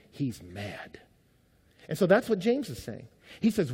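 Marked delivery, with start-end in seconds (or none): 0.1-1.0 low, hushed threat; quietly dreadful; ominous warning. Retold or imitated. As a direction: drop deep and soft for the warning. Warning that Dad comes home angry.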